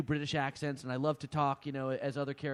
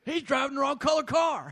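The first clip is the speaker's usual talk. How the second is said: 1 mock cop line; 8 semitones higher, 4 dB louder than usual. Mock cop quote, laughing.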